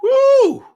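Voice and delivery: high, loud "woo"; mock-impressed whoop. Sarcastic reaction to the video.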